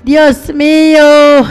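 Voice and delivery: ordinary talk, nothing marked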